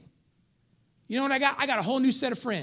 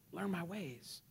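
"Learn my ways" repeated much softer; softer echo; 2 semitones lower, 13 dB quieter, slower than usual. Real, not acted. Preacher echoing God's words, much softer. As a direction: drop to near a hush.

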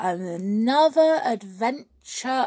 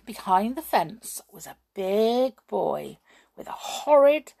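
ordinary delivery

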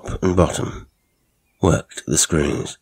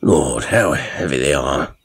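a lower, impatient grumble; 3 semitones lower than usual. A narrator's reading. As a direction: grumble that they weigh too much. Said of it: "he said impatiently".

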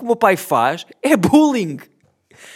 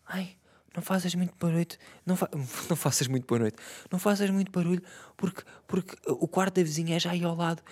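hushed mock whisper of the father, softer; imitating a whisper; 8 dB quieter, faster than usual. Father hushing son at midnight about the neighbour, whispered mock. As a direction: breathe it like a midnight scolding. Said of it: "chega ao meu quarto e diz assim".

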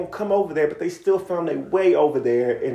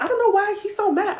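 mock girl voice. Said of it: "she's like".

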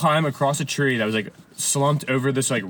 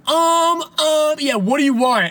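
high, drawn-out mimicking ums; teasing drive-thru worker, pitched way up; mocking; 14 semitones higher, 4 dB louder, much slower than usual. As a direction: make fun of a dithering customer. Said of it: "like, making fun of her".

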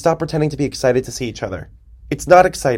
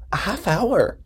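high, incredulous repeat; 8 semitones higher, 4 dB quieter, slower than usual. Real, not acted. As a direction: echo it in disbelief. Exclaiming disbelief at own spending.

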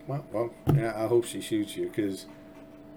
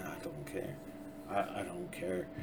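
breathy whispered I don't care; whispered aside; 14 dB quieter than usual. Real, not acted. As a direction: toss it off under your breath. Dismissive aside, dropped to whisper.

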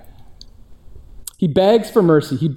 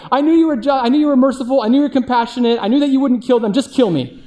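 high mock whine, dropping at the end; whiny Jonah; 7 semitones higher, faster than usual. Jonah griping at God for being merciful, high whine. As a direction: whine it like a sulking child. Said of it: "He's like".

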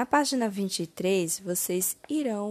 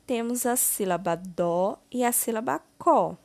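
usual speaking voice

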